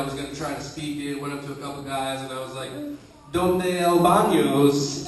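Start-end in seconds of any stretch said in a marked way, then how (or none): 3.3-5.1 slow, stiff, stilted broken Spanish; a hint of a robotic gringo accent. Tourist asking Mexican locals for the bathroom, stiffly. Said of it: "I kinda sound like a Spanish robot".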